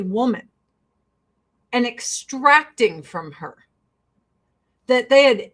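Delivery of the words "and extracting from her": normal talking voice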